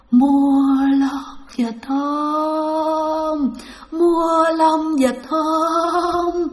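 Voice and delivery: long drawn-out ghostly vendor chant; eerie moaning call. Retold or imitated. Unseen voice calling to buy duck feathers in the graveyard, eerie moan. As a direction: moan each word out, very slowly. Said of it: "Mà rên thấy ghê lắm các bạn".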